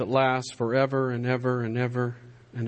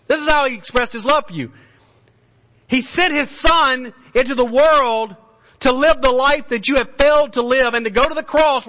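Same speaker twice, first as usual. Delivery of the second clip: high, loud fiery preaching; preaching at full force; 12 semitones higher, 9 dB louder than usual. Acted. Preacher confronting sinners, at full force. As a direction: bring the fire down on the room.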